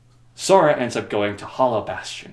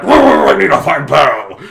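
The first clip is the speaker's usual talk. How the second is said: loud, slow hammed-up beast roar; 4 semitones higher, 13 dB louder, slower than usual. Beast announcing he must find Pearl, loud and slow. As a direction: bellow it like a big clumsy beast.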